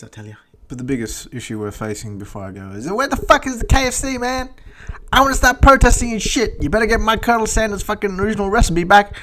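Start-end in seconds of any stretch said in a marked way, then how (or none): 2.9-9.2 high-pitched, loud comic indignation; hammed-up yelling complaint; mock outraged rant. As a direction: holler it like an aggrieved customer, voice pushed high. Mock rant about missing KFC.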